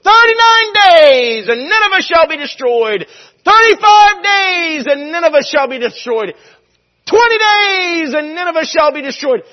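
high, slow thundering doom cry; shouting prophet Jonah. Retold or imitated. Jonah preaching doom to Nineveh, slow booming shout. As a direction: bellow the prophecy over the city.

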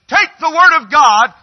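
loud, high preacher's command; startling shout. Retold or imitated. Preacher recalling his shout that made churchgoers jump, startling shout. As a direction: belt it out to make them jump. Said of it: "some of you jumped".

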